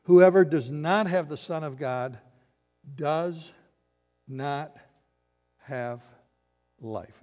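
loud "Whoever", then "does... not... have... life" one by one; emphatic pauses. Shown as a demonstration. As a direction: break before each of "does", "not", "have", "life", dropping away. Preacher hammers the scary verse.

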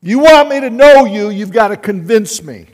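high, loud challenge shouted at the sky; defiant demand. Retold or imitated. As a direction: dare God to prove himself. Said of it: "That's the way I talk to God".

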